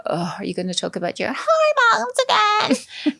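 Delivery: put-on high whining teen voice, groaning at mum; a sulky, put-upon child dragging out the complaint; whiny eye-rolling daughter.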